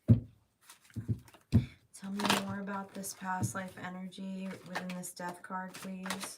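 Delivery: slowed, coaxing ask to the cards. Real, not acted. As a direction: draw it out gently. Reader asks the cards slowly.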